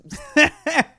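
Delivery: high, loud burst of real laughter; a sudden laugh that bursts out at the clip. Real, not acted. Reacts to weight-loss clip.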